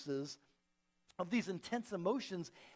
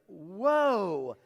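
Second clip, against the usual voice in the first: a louder, breathy-free gasp of surprise; stunned whoa; 8 dB louder than usual. Retold or imitated. Mother stunned by her son's blunt question, surprised whoa. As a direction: caught off guard by a child's words.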